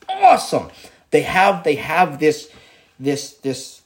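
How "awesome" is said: awesome jumps way up in a high excited squeal. Real, not acted. Raving to viewers about Exodus, awesome squealed high. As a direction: let awesome fly up high with glee.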